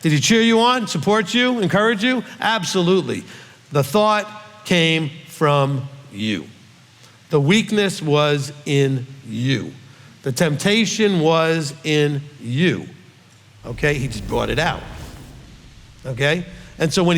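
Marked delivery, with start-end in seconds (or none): 3.7-12.9 each line slow and deliberate, weight on weakness and temptation; pause-heavy preacher emphasis on "weakness" and "temptation"; slow hammered point. Real, not acted. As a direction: drive home every word, slowly. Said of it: "I just really need to ha-, hit this so hard".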